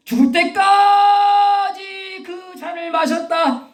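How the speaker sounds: high, loud, long-held wail on 때까지; preaching cry. Real, not acted. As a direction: stretch 때까지 out in a soaring shout. Preacher crying out at climax. (길게 늘여 외치는 설교)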